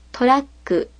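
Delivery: トラック with a silent beat before "ku"; トラック with a held k; double consonant. Shown as a demonstration. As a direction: stop for a beat inside トラック, tora-(pause)-ku. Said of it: "as if there is a syllabic length of silence".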